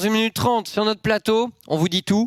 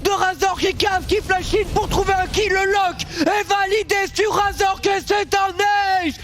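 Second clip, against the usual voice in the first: hype casting; 12 semitones higher than usual. Real, not acted. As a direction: shout the kill like a caster. Play-by-play caster hype call. (commentaire survolté)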